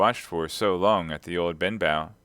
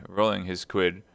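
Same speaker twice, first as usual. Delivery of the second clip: usual speaking voice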